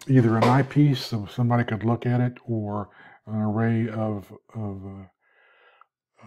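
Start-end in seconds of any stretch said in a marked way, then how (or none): 3.9-6.3 words stretched by a yawn. Real, not acted. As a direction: talk through a yawn. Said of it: "gosh, I can't stop yawning".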